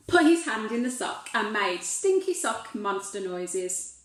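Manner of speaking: plain reading voice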